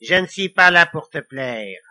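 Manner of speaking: loud devilish retort; barked. A narrator's reading. Devil answering Lily's dislike, barking. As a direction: bark it back at her. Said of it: "aboya le diable".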